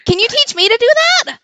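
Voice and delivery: loud, high, thrilled outburst; amazed shout. Real, not acted. Player amazed at turtle splitting table, excited shout. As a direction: shout out in awe at the trick.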